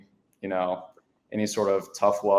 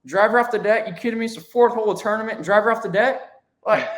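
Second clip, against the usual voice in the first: outraged coach; 13 semitones higher, 5 dB louder, faster than usual. Retold or imitated. Mimicking coach's outraged reaction.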